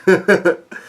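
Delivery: short high chuckle; laugh. Real, not acted. Reader amused by Sanji's swooning, chuckling. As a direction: chuckle after reading the line. Amused at his own retold line.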